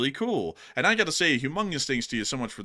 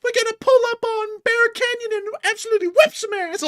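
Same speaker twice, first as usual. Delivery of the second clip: very high, loud squeaky pup voice; youth gang marmot; 6 dB louder than usual. Acted. Marmot pup announcing a youth gang, very high British squeak. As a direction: pipe up as a tiny British gangster pup. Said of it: "I respect the youth gang marmots".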